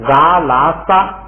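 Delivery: 杂拉撒 drawn out at half speed, each syllable clear; teacher spelling out three letters; slow letter names. Shown as a demonstration. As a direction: say 杂拉撒 slowly, like naming letters on the board. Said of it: "注意一下这个杂拉撒的写法".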